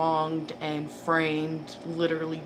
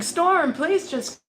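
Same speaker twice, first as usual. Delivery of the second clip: pleading; 5 semitones higher, 6 dB louder, slower than usual. Retold or imitated. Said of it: "You're going".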